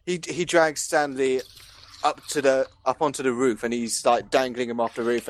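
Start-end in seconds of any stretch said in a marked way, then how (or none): none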